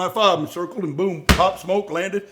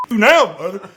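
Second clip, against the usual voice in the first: raised voice; 5 semitones higher, 7 dB louder than usual. Retold or imitated. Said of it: "I started raising my voice".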